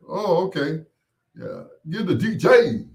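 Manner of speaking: high, loud mock voice stressing D J; hammed-up imitation leaning hard on D J; mocking emphasis on D J. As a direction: caricature of a patronizing bar patron. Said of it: "they have to emphasize on the D J".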